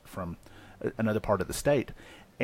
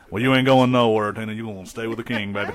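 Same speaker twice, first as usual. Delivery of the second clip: [name] voice; 7 dB louder than usual. Pretend. [name] bit on the show.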